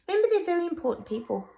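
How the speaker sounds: quick, high-pitched mock reminder. Repeats phrase in mock reminder.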